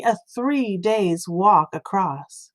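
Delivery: ordinary delivery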